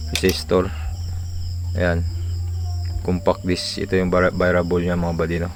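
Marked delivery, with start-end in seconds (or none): none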